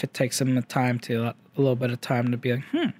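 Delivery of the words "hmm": short high-pitched "hmm" mimicking a friend; mock deliberating hum; imitated pondering hum. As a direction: imitate his brief thinking noise, pitched up. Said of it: "He always makes that noise too".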